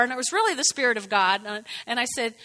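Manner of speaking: ordinary talk, nothing marked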